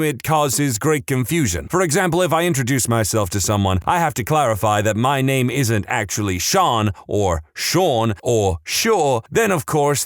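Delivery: ordinary talk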